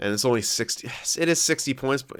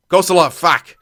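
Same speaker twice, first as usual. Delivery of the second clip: loud, higher curse of annoyance; frustrated outburst; 7 semitones higher, 9 dB louder than usual. Real, not acted. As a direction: blurt it out in exasperation. Frustrated at missing player.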